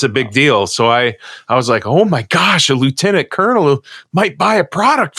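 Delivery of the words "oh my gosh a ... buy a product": excited. Retold or imitated. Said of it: "I just got all excited about it".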